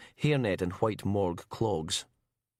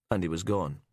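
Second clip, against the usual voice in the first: ordinary delivery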